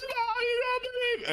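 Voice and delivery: very high, slow imitated wail; mock hysterical wail. Neighbor calling her mom, mock hysterical high wail. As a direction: squeal it like someone sobbing down the phone.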